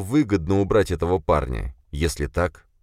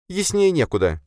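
high female voice, curt reply; sharp, high-voiced comeback; sharp retort; 13 semitones higher than usual. A narrator's reading. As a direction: answer sharply, pitched well up. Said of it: "резко ответила она".

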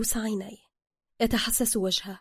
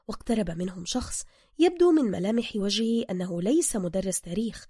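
plain reading voice

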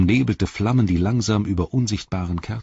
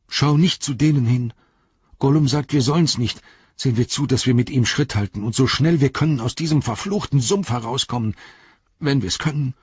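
drängend; 7 semitones higher, 4 dB louder than usual. A narrator's reading. Sam drängt Frodo zur Eile.

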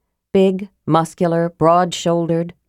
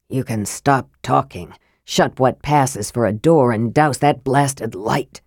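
irritated Raistlin; 5 semitones lower than usual. Acted.

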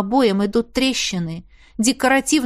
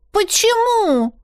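put-on high, slow little girl's question; small girl voiced high and drawn out; child asking; 10 semitones higher, slower than usual. A narrator's reading. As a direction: ask like a small puzzled girl. Little girl asks mother.

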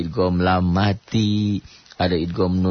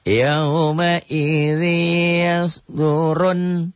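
slow, high chanted tilawah; Quran recitation; 9 semitones higher, much slower than usual. Shown as a demonstration. Teacher reciting the verse for the class, slow chant. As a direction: draw the words out melodically.

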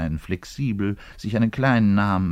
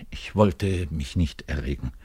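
usual speaking voice

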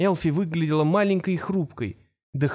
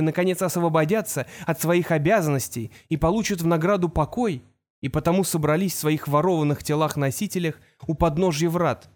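usual speaking voice

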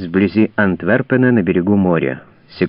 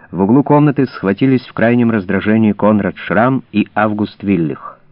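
ordinary delivery, nothing marked

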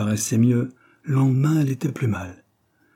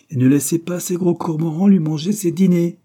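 higher, louder proud warning; 8 semitones higher, 6 dB louder than usual. A narrator's reading. Servant warning about the greedy boys, proud. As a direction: toss it out emphatically on leaving. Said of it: "dit fièrement Dobbs".

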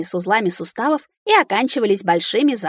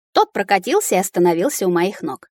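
ordinary delivery, nothing marked

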